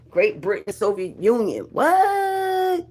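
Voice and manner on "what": high, long-held whoop of a what; amazed. Real, not acted. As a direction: hold the word high in amazement. Amazed at viewers from abroad.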